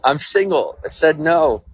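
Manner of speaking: high loud protest; mock protest. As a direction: yell no at yourself. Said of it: "And I'm like".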